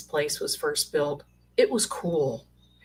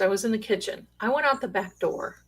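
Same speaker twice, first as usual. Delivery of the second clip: normal talking voice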